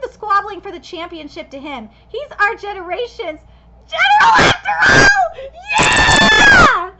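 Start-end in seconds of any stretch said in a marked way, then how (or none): 3.9-6.9 high, loud triumphant yell; ecstatic cheer. Real, not acted. Fan cheering on the new general, ecstatic. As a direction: cheer as if the team just won.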